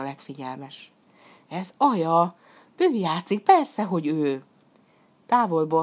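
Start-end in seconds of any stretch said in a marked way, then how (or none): 1.5-4.4 sudden glad realisation; excited, drawing out the last words; light up as you recognise someone dear; excited recognition. Acted. Hero recognises his sister's flute.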